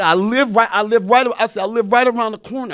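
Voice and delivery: loud, high, urgent repeated insistence; insistent protest. Retold or imitated. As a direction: plead your case loudly, rushing at the end. Insisting to the woman who questions him.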